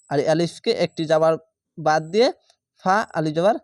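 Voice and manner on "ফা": normal talking voice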